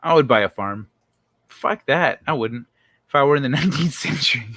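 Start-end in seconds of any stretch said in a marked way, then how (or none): none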